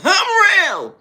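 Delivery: very high, loud mock holler; exaggerated high-pitched boast; mocking boastful yell. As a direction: mimic a braggart screaming it. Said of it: "got so much to say".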